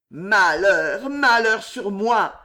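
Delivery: loud, drawn-out anguished lament; râle de désespoir. A narrator's reading. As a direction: groan it out in despair, stretching the words. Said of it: "râla le chevalier d'Assas". (râle désespéré, voix forte et traînante)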